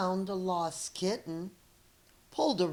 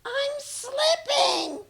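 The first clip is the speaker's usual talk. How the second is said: very high, slow, loud screech; 7 dB louder, slower than usual. Acted. Cat hanging from a branch screeching in fear. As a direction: shriek it as you lose your grip. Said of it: "Clevis screeched".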